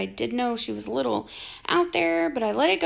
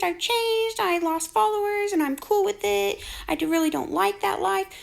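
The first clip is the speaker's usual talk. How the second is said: mocking quote; 8 semitones higher than usual. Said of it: "keeps going on about how she's changed".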